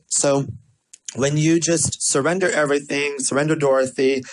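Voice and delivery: normal talking voice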